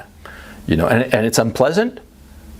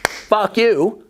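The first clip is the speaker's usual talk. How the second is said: mock refusal; 7 semitones higher than usual. Retold refusal again.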